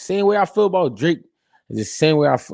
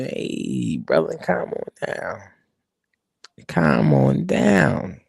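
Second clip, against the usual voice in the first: low, soft, very slow soothing; calm old boss; 8 semitones lower, 6 dB quieter, much slower than usual. Retold or imitated. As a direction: drag it out to calm him down. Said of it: "He be".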